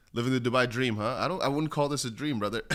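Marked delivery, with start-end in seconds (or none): none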